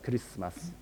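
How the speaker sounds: "Christmas" with whispery inserted vowels, very soft; softly, "Christmas" as ku-ri-su-mas, the added vowels devoiced. Shown as a demonstration. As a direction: say "Christmas" with Japanese-style extra vowels, barely voiced. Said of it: "they usually devoice these".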